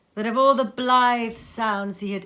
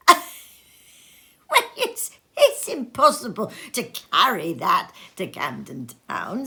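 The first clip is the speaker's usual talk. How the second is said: lightly voiced Scrooge; 4 dB louder, slower than usual. A narrator's reading. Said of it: "said Scrooge".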